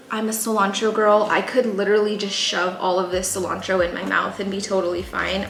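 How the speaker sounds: ordinary talk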